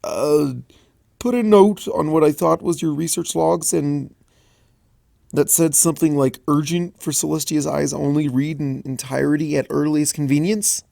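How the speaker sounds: a hint of a nervous young dragon; sheepish, hesitant confession; lightly voiced Spike. Spike admitting his mistake, a little nervous.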